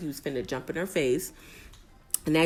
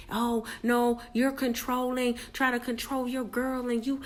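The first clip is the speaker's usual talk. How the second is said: higher, slow mocking whine; mocking housemate voice; 7 semitones higher, slower than usual. Recapper parodies the houseguest calling him controlling, higher mocking whine. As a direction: drag out the accusation to ridicule her.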